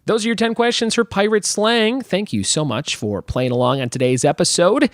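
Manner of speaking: ordinary delivery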